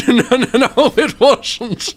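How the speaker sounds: high, laughing no no; laughing denial. Real, not acted. Streamer laughing at own mistake, high giggly denial.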